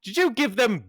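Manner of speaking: high, loud, outraged question; indignant shout. Acted. Jason demands to know who gave them beer. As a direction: accuse them in disbelief.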